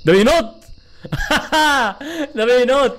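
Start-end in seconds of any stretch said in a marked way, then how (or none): 1.0-2.3 laugh. Real, not acted. Startled by jumping monster.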